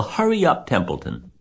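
very high-pitched urging; urgent plea. A narrator's reading. Wilbur to the dawdling rat, urgent plea. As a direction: squeal it in desperate haste.

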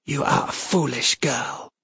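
sharp, severing. A narrator's reading. Goddess belittles the princess, sharp and severing. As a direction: let each word fall like an axe blade. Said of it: "Each word fell like an axe blade".

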